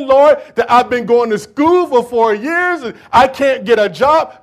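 loud, rising preacher's cry, pleading with God; loud pleading sermon cry; preaching at full force. Acted. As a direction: build the plea into a shouting sermon climax. Preaches the disciples' plea.

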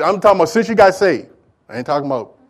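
ordinary talk

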